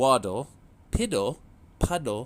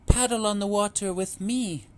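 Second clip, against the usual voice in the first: high, louder coaxing call; mother duck calling; 9 semitones higher, 7 dB louder than usual. A narrator's reading. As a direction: coax the babies onto the water. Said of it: "Mama called".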